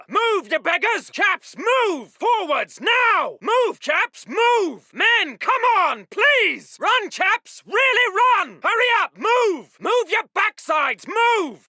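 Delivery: high, loud exasperated urging; bellowed order. Acted. Officer driving his beggars forward, bellowing. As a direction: yell at them to get moving.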